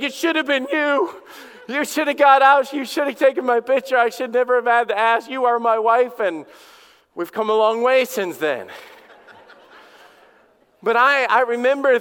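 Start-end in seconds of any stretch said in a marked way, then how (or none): 0.0-6.2 replaying his honeymoon huff, much higher and louder; retold angry outburst. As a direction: scold the new wife all over again, half laughing. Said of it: "I, I'm mad".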